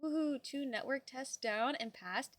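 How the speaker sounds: ordinary talk, nothing marked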